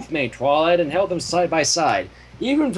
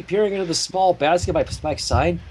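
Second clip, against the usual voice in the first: usual speaking voice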